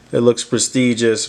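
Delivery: usual speaking voice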